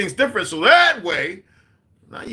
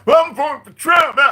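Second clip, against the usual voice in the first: much higher and louder mocking shout; mocking goofy voter; 10 dB louder than usual. Speaker mocks goofy Trump voters with a loud hammed-up voice. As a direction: mimic a clueless guy bragging, hammed-up.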